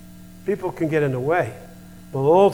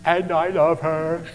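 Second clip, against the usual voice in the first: slow, put-on toddler echo; granddaughter mimicking him; 3 semitones higher, slower than usual. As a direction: copy grandpa's drawn-out words as a little kid. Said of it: "And she goes".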